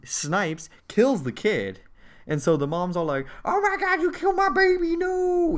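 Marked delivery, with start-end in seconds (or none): 3.4-5.6 very high hammed-up mother's wail; mock grief-stricken wail. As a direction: wail like a bereft mom in falsetto. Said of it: "In a very sad and really messed".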